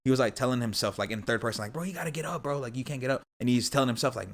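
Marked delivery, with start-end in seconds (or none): 1.7-3.2 higher-pitched retold pep talk; retold self-urging. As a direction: mildly urge yourself on, acting it out.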